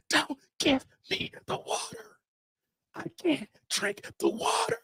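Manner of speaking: faint whispered refusal; hushed, high and weak. Retold or imitated. Weak pleading while near collapse.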